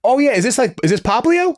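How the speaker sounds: high, sudden realization; surprised. Real, not acted. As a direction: catch on all at once. Sudden recognition.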